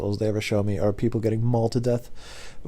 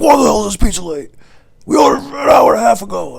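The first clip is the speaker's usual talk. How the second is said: high, loud mock rant; screaming customer; 11 semitones higher, 7 dB louder than usual. Drunk customer angry about late pizza, imitated screaming complaint. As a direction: yell it like a furious drunk customer. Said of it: "he's screaming at me".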